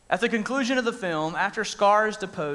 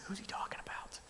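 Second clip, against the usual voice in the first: whisper; 2 semitones higher, 16 dB quieter than usual. Retold or imitated. Peter motions John to ask.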